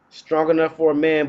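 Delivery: ordinary talk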